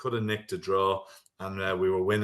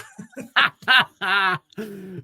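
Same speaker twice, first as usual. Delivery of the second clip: big laugh; 10 semitones higher, 7 dB louder than usual. Real, not acted. Reacts to his own joke.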